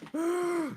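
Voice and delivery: a sharp, high gasp of shock; high mock gasp. Retold reaction in car.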